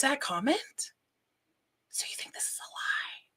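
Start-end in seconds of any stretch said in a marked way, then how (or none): 1.9-3.2 breathy stage whisper; hushed conspiratorial aside. Pretend. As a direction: lean in and whisper it like a secret. Mock conspiratorial aside to viewers.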